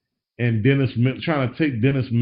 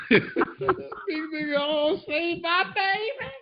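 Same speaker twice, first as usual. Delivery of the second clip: doting mom impression. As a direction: beg dramatically like a sentimental mom.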